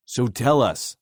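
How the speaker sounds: demanding. Acted. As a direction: insist he spill it. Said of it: "Fox demanded".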